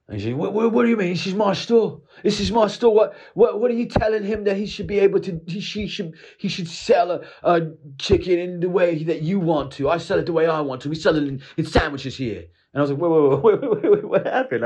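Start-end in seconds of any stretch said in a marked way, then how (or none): 0.4-12.4 blustering owner defending his store, pitched way up; outraged shopkeeper squawking at a customer; angry shop owner. Retold or imitated. 13.0-14.6 higher, louder flustered wait wait; stunned customer trying to calm things; startled, high-pitched confusion; baffled. Retold or imitated. His own baffled reaction.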